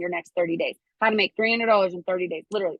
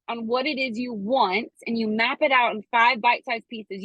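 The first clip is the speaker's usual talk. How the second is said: usual speaking voice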